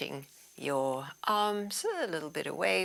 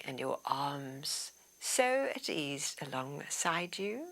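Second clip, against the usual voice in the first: guided meditation. Acted. Leads body relaxation.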